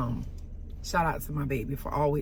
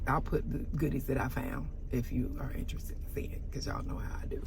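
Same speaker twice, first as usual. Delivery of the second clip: confiding whisper to the viewers, much softer; whispered aside; 5 semitones lower, 9 dB quieter than usual. Real, not acted. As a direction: let it slip out as a secret aside. Aside to viewers mid-story.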